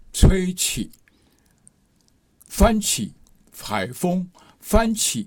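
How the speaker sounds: 吹起 and 翻起 lifted high and pressed; 翻起 said high and firm; stressed demo words. Shown as a demonstration. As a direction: call out each example word high. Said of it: "我们就讲到这动名词".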